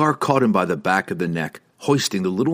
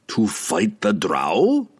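musing; much slower than usual. A narrator's reading. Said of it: "Pook mused".

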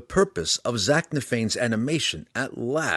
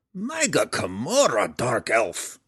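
shouting back; slower than usual. A narrator's reading. Belwar arguing with Drizzt, shouting back. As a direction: shout it back, pitched up then dropping. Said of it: "Belwar shouted back at him".

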